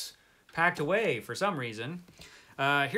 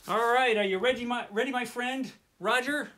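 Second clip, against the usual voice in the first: high, louder pet-talk voice; sing-song call to a pet; talking to the dog; 10 semitones higher, 3 dB louder than usual. Real, not acted. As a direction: coo at your dog.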